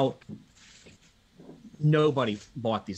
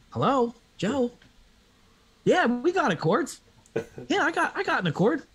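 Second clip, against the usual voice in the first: mock phone caller; 10 semitones higher than usual. High eager caller ringing Joe about the Accord. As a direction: ham up a keen buyer ringing about the Accord.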